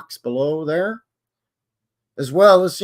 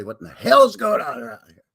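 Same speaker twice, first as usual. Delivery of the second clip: mock exclamation; 9 semitones higher, 6 dB louder than usual. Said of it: "just say".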